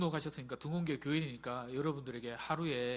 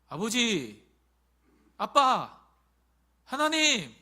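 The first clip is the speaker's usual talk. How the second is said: loud, rising cry to God; child calling father, much louder; 6 semitones higher, 10 dB louder, much slower than usual. Acted.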